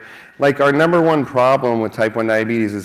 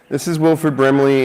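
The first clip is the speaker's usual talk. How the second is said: ordinary talk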